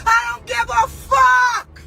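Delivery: high, loud, slow yell; defiant shout. Real, not acted. Defying critics of her music career, shouting. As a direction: throw it back at the critics.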